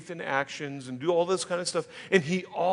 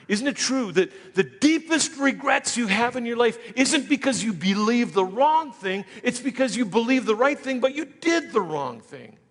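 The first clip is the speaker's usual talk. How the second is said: loud, rising preacher's cry; preaching at full force; 5 semitones higher, 7 dB louder than usual. Acted. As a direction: drive the point home to the congregation.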